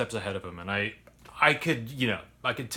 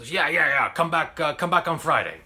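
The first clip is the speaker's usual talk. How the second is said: higher, louder, brushing him off; dismissive boss; 6 semitones higher, 6 dB louder than usual. Retold or imitated. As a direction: wave him away like an impatient owner.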